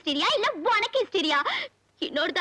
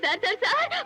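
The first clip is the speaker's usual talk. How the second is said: crying out sir over and over; crying plea. Acted. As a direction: sob and beg for help. Woman bursts in crying for help.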